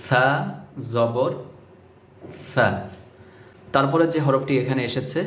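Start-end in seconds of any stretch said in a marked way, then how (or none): 0.1-2.7 সা with the tongue tip touching the upper front teeth, then জবর; careful pronunciation. Shown as a demonstration. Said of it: "খেয়াল করুন আমি কিভাবে বলছি".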